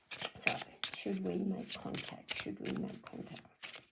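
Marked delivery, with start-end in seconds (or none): 2.4-3.8 hushed, breathy asking; a private whispered question over the cards. Real, not acted.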